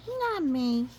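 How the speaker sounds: high, loud mock denial; insist, squeaky and innocent. Rabbit denying the mischief, high and loud.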